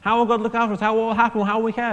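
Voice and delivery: worried people in the desert, mildly mock panicking; mock worry. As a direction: ask like a nervous crowd, lightly hammed. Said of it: "they'll panic".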